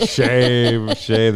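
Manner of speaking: loud, high chant of shame; playful town-crier condemnation; mock public shaming. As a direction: echo the shaming cry, laughing as it starts.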